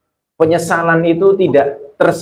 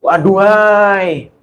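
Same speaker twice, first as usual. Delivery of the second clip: drawn-out exclamation; 5 semitones higher, much slower than usual. Retold or imitated. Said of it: "seolah-olah ia mengatakan".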